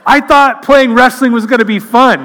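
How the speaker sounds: put-on high, loud wail of a disappointed little boy; whiny little kid. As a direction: cry out like a kid whose fun was ruined. Crushed kid's imagined thought.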